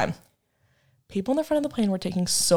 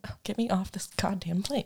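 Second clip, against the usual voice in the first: breathy whispered plea; 2 semitones lower, 12 dB quieter than usual. Retold or imitated. Retold despair on circling plane.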